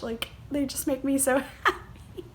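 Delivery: high, giggling through the words; laughing with joy. Real, not acted. Vlogger gushing about the bracelets, laughing high. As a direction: laugh as the joy spills over.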